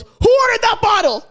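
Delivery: loud, high demanding shout; retold shout. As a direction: call it out across the room. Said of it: "runs from the other side and goes".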